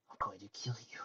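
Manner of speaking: very soft, whispered threat; barely voiced promise to kill; murderous whisper. A narrator's reading. Murderous threat in story dialogue.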